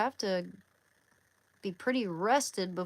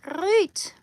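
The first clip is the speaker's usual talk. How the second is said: high, loud mock-enthusiastic great; sarcastic great; 12 semitones higher, 10 dB louder than usual. Real, not acted. Reacting sarcastically to a friend, high and loud. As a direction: overdo the cheer, clearly fake.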